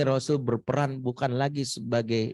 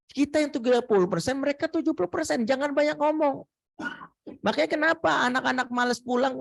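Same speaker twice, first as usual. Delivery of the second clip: ordinary talk, nothing marked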